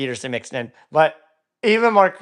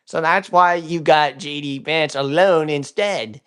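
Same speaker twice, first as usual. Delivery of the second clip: ordinary delivery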